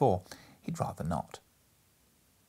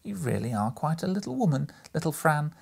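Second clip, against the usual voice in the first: exclaimed; 7 semitones higher than usual. Acted. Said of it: "exclaimed the boy".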